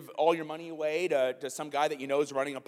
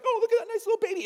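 high, fast mock baby talk; imitating a stranger cooing, high and quick; 4 dB louder, much faster than usual. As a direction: coo at the baby like a doting stranger.

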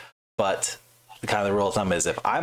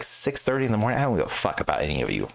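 ordinary talk, nothing marked

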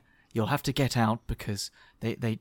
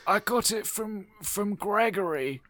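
character voice; 8 semitones higher than usual. Acted. Player voicing his creature explaining its home. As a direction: play a high voiced creature.

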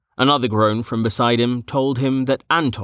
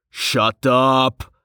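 moderately raised, irritated snap; snapped; 3 semitones higher, 3 dB louder than usual. A narrator's reading. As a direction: snap to cut him off. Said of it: "Hagar snapped".

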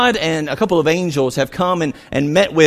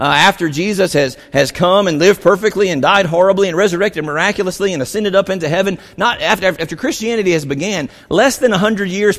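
normal talking voice

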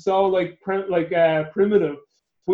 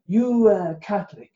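broken English; 4 semitones higher, slower than usual. Retold or imitated. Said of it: "In his broken English, he goes".

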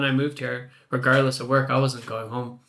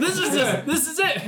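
high, laughing, hammed-up British accent; bad British accent; 12 semitones higher than usual. Shown as a demonstration. Guest shows hosts his terrible British accent, badly faked British. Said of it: "Can we hear your British accent".

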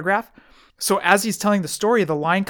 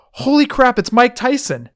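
high, louder excited exclamation; imitated starstruck excitement; 7 semitones higher, 5 dB louder than usual. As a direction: act out someone spotting a celebrity. Imitating lady spotting a celebrity.

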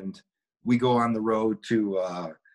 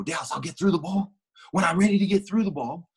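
higher-pitched retort to a coach; defiant comeback, retold with raised voice; talking back; 7 semitones higher than usual. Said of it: "you can't talk to a coach like that".

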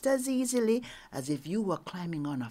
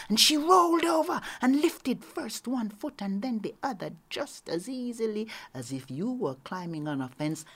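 ordinary delivery

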